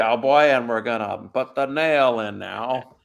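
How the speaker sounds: slow cowboy drawl; John Wayne. Acted. Centurion at the cross, drawling cowboy voice. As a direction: stretch it out like an old western star. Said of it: "do John Wayne as a Roman centurion".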